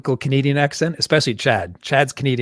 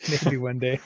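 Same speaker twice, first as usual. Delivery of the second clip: laughing softly; 5 dB quieter than usual. Real, not acted. Repeats it through a laugh.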